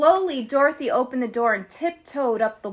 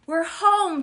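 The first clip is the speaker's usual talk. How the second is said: joyful cry; 6 semitones higher, 5 dB louder than usual. Acted. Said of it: "Dorothy cried".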